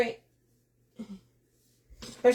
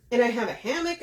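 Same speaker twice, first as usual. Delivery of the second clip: usual speaking voice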